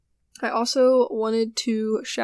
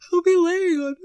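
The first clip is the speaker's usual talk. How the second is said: higher and louder, words pulled out by a yawn; 8 semitones higher, 5 dB louder than usual. Real, not acted. Sleepy host telling listeners about her puppy, yawning. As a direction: yawn through the phrase. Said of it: "I don't know why I'm yawning".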